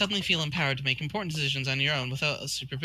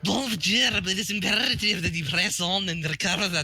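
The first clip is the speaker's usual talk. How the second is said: put-on botched accent that lands in Scottish; failed French accent turning Scottish; 3 semitones higher, 3 dB louder than usual. Reader attempting a foreign accent, slipping into Scottish. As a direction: try for French or Spanish, come out Scottish. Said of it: "That's Scottish".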